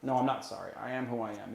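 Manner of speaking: ordinary talk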